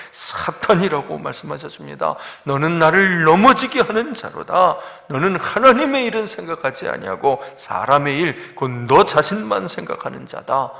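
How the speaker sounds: loud retold reprimand; severe, emphatic imitation of scolding; stern rebuke. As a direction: reproach him forcefully, as a master scolding a disciple. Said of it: "라면서 책망하신 거지요".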